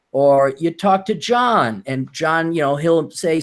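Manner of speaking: usual speaking voice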